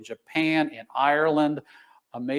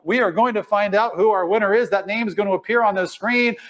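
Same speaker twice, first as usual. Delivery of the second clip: ordinary talk, nothing marked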